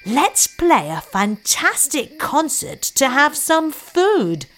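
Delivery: a donkey proposing a plan; clever donkey. Acted. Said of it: "says the donkey".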